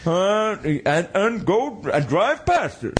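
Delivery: high, put-on fretting driver; fussy driver voice. Comic host plays a driver telling others to back off, high fussy voice.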